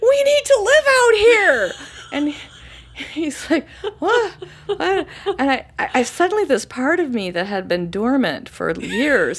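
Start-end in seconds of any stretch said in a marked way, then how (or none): 0.0-1.7 high, loud and slow joyful yell; shout. Retold or imitated. Wife telling husband they must move here, loud slow shout. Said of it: "I shouted". 4.0-5.1 baffled laugh. Retold or imitated. Husband reacts in disbelief.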